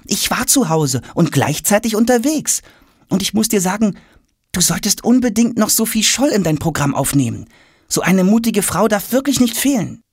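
put-on high-pitched boy's voice; eager, insistent boy. A narrator's reading. Tom jumps up to advise father, insistent. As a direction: press your point in a high boyish register.

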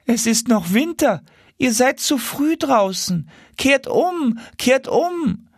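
high, fussy goose warning; a tame goose scolding the early flyers. Acted. Tame geese warning the wild geese, high goose voice.

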